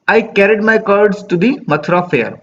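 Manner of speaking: slow, measured recitation of the verse; poem reading. Acted. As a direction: read the line slowly, savouring it. Reading poem line slowly.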